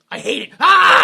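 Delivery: very high, very loud yell; enraged mock scream. Manager rejecting the writer's ending, hammed-up furious yell.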